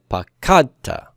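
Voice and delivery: pakadta slow, stressed on KAD; pa-KAD-ta, pakadta stressed mid-word; stress on middle syllable. Shown as a demonstration. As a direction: hit KAD in pakadta, light final ta. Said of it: "try to stress root two".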